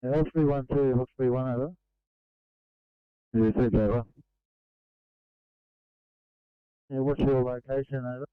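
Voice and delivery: tinny two-way radio voice; crackly construction walkie talkie. Real, not acted. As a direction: speak through a crackling handheld radio.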